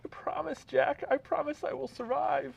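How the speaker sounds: a man pitching up to play Rose. Acted. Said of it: "I'm gonna play the part of Rose".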